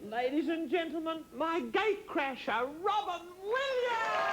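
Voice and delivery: slow build to a high, stretched name; showbiz introduction. Real, not acted. Host introducing gate crasher to audience, grand announcement. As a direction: present the guest grandly, soaring on the name.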